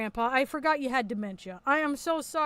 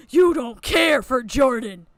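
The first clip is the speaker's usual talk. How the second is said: loud, slow mock Batman growl; 9 dB louder, slower than usual. Streamer mocking Lio's Batman line with a loud, slow imitation. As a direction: parody his gravelly hero voice. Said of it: "Why are you doing the Batman voice?".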